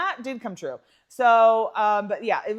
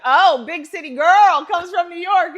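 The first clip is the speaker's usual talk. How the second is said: mock movie hunk; 8 semitones higher, 12 dB louder than usual. Retelling a movie character's line.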